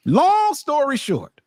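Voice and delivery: high, slow, stretched-out announcement; drawn-out exclamation. Acted. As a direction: stretch it out high to cut the story off. Repeats it the same way.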